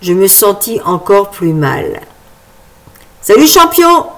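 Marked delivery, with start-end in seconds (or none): 3.2-4.1 higher, louder cheery hail; a dad hailing the boy, higher and louder; hearty call. Acted. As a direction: toss out a big friendly greeting. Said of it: "lui lança le fameux Eric".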